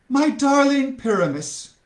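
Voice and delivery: high swooning voice; lovesick heroine. Acted. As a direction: swoon like a stage lover. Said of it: "said Francis".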